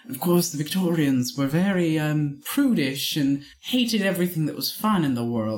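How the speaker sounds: prudish Victorian British character; British accent. Shown as a demonstration. Cohost describing Victorians in a prudish British accent. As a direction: explain primly in a British accent. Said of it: "if you talk like this, you're prudish".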